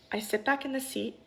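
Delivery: ordinary talk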